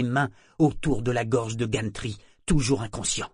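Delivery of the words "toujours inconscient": ordinary delivery, nothing marked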